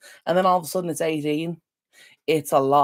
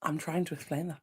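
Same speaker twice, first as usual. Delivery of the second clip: whisper; 11 dB quieter than usual. Real, not acted. Hushed aside to a viewer.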